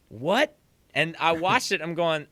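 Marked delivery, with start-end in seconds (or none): 0.1-0.6 rising, higher mock-baffled question; retold bafflement. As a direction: let it swoop up in disbelief. His baffled reaction, retold.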